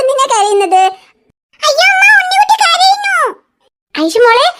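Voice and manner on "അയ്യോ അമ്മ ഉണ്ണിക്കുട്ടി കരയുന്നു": put-on child calling out. Child shouting to mother that the baby is crying. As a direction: yell for mum across the house.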